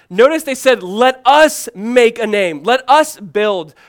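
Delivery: "us" drawn out slowly and loud; stress on US. Shown as a demonstration. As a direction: lean hard on "us", high and emphatic. Repeats verse to highlight let us.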